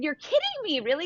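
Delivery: can't believe what she's hearing; surprised disbelief. Retold or imitated. Retelling her shock at Dr Drew's son's book, high surprised disbelief. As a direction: pitch jumps way up in disbelief.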